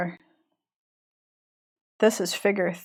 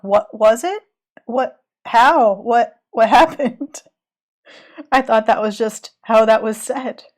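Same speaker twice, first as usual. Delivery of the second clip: mock-baffled, louder, halting questions; retold bafflement; 7 dB louder than usual. Southerner caught out by a friend, mock-baffled and louder. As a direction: replay your own confusion with a laugh. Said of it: "someone I know will be like".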